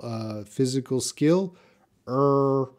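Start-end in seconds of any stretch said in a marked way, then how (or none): 2.1-2.7 "Er" held as one steady R-coloured vowel; isolated R sound. Shown as a demonstration. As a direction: sustain the "Er" sound alone. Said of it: "my tongue is".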